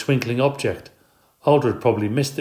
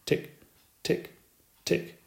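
soft whispered ticks; ticking clock; 7 semitones higher, 9 dB quieter, slower than usual. A narrator's reading. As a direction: tick like a clock, very softly. Clock ticking in his head.

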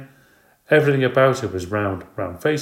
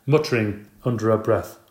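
plain reading voice

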